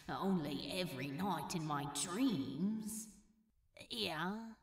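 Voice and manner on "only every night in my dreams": quiet, chuckling flirt; low laugh then a quiet sly reply; soft amused murmur. Acted. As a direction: murmur it teasingly.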